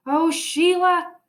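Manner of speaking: loud, high, slow exasperated call; loud drawn-out exasperated shout. A narrator's reading. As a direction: shout it out, fed up. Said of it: "I shouted".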